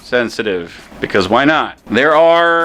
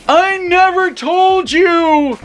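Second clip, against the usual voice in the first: high, slow, drawn-out yell; loud hammed-up cry; mock scream; 5 dB louder, much slower than usual. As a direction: imitate a desperate shout, stretching each word. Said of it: "is the word Rey screamed".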